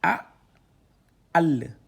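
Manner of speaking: loud, drawn-out syllables read one by one; syllable reading drill. Shown as a demonstration. Teacher spelling out syllables loudly for students. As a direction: sound out each letter for the class.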